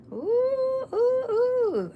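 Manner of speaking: very high, drawn-out delighted oohs; excited "ooh". Real, not acted. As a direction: coo high at a brilliant idea. Delighted by a new idea.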